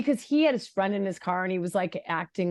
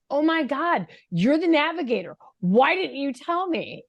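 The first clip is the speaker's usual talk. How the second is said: higher, louder frustrated yell at the son; exasperated outburst; 7 semitones higher, 4 dB louder than usual. Retold or imitated. Mother yelling at her son for missing a turn, exasperated outburst. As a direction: let it burst out at the navigator. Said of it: "my voice raised".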